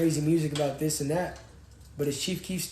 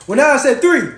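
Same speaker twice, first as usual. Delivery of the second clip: "three" loud and insistent; 9 semitones higher, 13 dB louder than usual. Real, not acted. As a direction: punch "three" hard, louder. Correcting viewer on stream.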